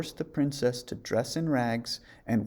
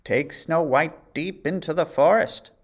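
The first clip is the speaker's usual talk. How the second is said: queen's order; 5 semitones higher, 6 dB louder than usual. A narrator's reading. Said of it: "she said".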